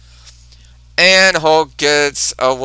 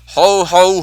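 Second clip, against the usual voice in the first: Santa ho ho; 4 semitones higher, 4 dB louder than usual. Retold or imitated. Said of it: "He yells".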